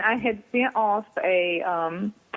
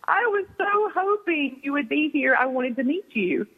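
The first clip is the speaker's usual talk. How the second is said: gushing fan; 6 semitones higher than usual. Retold or imitated. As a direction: imitate a thrilled admirer. Retold fan's eager greeting.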